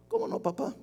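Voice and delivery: vocecita aguda de hijo obediente. Acted. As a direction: imita al hijo contestando con voz muy aguda. Imita al hijo obediente que responde. (hijo obediente, con voz aguda)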